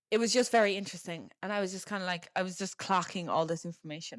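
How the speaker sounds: ordinary talk, nothing marked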